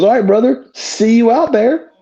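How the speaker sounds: casual pal send-off; wrestler bro. Retold or imitated. Wrestler with baby to opponent, easy bro farewell. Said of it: "he goes".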